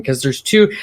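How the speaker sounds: ordinary talk, nothing marked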